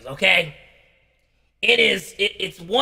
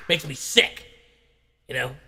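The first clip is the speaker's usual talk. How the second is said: normal talking voice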